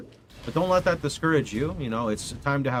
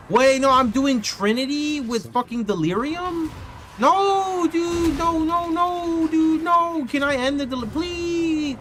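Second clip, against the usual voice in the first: much higher and louder, pleading in disbelief; frustrated yelling; 14 semitones higher, 4 dB louder, slower than usual. Real, not acted. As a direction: yell at the game in frustration. Delirium ruins his map run.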